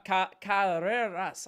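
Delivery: Carreras with a drawn-out, heavily trilled R attempt; attempted Spanish R. Shown as a demonstration. Streamer struggling to say a Spanish player's name, attempted rolled R. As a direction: stretch the R in Carreras, slow and emphatic. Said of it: "how do Spanish people say it".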